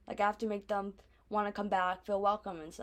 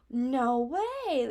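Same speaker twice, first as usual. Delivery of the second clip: mock gasp, then higher-pitched disbelief; imitated amazement; 5 semitones higher, 4 dB louder than usual. Imitating people hearing speaker is from New York, amazed. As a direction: gasp like an impressed fan.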